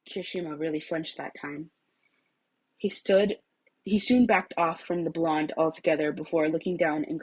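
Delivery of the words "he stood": usual speaking voice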